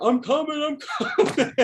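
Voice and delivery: high excited holler from the seats. Retold or imitated. Rushing down to the stage after his name is called, high excited holler. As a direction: call out high and eager while rushing down. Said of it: "I was hollering down there".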